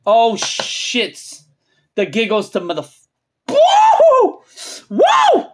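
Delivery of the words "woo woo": high, loud woo; hyped whoop. Real, not acted. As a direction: whoop at the top of your range. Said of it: "I'm getting hyped".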